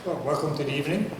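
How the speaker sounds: ordinary talk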